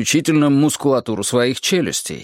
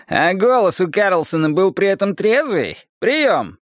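slightly performed, higher-pitched policeman on the radio; radio call; 6 semitones higher than usual. A narrator's reading. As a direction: put the question over the radio, raised pitch. Policeman calls into radio microphone.

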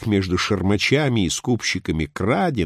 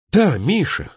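higher-pitched sudden cry to a friend; sudden exclamation; 10 semitones higher, 3 dB louder than usual. A narrator's reading. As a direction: exclaim it as if just remembering. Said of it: "воскликнул вдруг гражданин". (внезапное восклицание)

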